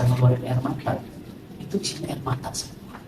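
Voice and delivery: unvoiced, fading sorrowful aside; hushed whisper. Real, not acted. Preacher quietly tells of tearful messages. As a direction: whisper it, heavy-hearted.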